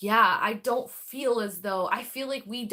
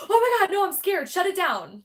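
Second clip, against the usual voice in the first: high, loud panicked cry; imitating a spooked yelp, then urging to stop; mock scared; 10 semitones higher, 6 dB louder than usual. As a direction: act out being frightened, pitched way up. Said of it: "so loud and so intense that I'm like".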